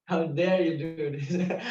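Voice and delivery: half-amused replay of being upset; mock outrage. Retelling his scolding of the friend, mock outrage. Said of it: "so upset with him".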